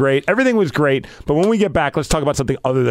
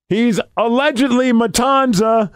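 high, slow ring announcer delivery; played-up announcing; 9 semitones higher than usual. Announcer playing it up in the ring, hammed-up. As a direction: draw it out for the crowd. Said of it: "I'm, like, playing it up".

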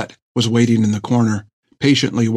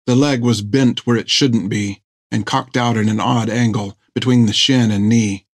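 plain reading voice

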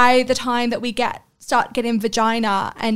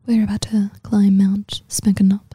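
seductive whisper into the mic; breathy mock-sultry whisper; giggly prank whisper; 3 semitones lower than usual. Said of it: "whisper into the mic really seductively".

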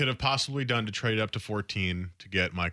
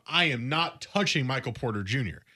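usual speaking voice

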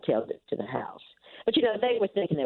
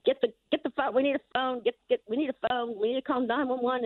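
mock frantic yelling; 10 semitones higher than usual. Grandmother retells son-in-law bursting in after a carjacking, mock frantic yell. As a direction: imitate a panicked call for a phone. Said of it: "he's yelling and screaming".

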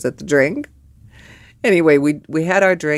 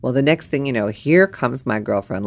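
ordinary talk

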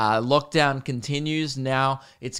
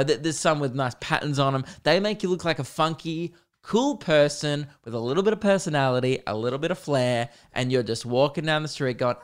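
ordinary talk, nothing marked

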